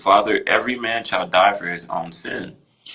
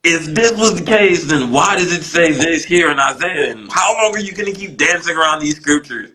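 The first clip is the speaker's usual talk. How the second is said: retold scolding; 6 semitones higher than usual. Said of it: "he just took me to task".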